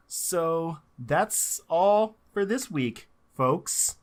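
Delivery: drawn out, very slow; slow motion. Real, not acted. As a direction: stretch every word out slowly. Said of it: "I don't know why I'm talking so slow".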